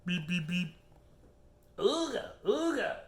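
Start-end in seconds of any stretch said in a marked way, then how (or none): 1.8-3.0 high ooga horn honk; old car horn imitated for a child.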